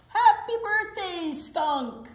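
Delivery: high, loud, drawn-out greeting; celebratory cheer. A narrator's reading. Friends greet Skunk at party, celebratory cheer. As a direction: call it out in jubilation.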